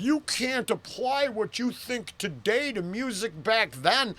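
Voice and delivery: high whiny imitation of a commenter; mocking commenter voice. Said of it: "and they're like".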